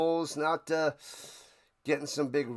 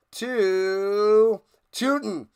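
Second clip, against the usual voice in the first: loud, slow announcer finish on the receiver's name; drawn-out touchdown call; 4 semitones higher, 5 dB louder, much slower than usual. Acted. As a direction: stretch "Tootin" long and loud like a play-by-play climax. Calling a touchdown catch.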